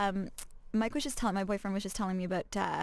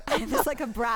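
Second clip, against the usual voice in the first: laughing; 6 semitones higher, 4 dB louder than usual. Real, not acted. Laughing at her own brap attempt.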